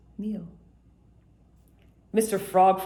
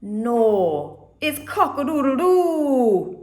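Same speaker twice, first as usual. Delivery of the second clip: louder, slow rooster crow; interrupting rooster; 5 semitones higher, 7 dB louder, much slower than usual. A narrator's reading. Jamaican rooster interrupting the others, loud slow crow. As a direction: cut in and crow it slowly.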